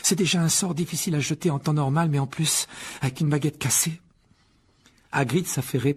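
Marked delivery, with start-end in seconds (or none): 0.0-4.0 put-on higher, mildly anxious girl; worried. A narrator's reading. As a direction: explain with a touch of worry. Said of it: "d'un ton inquiet". (un peu inquiète)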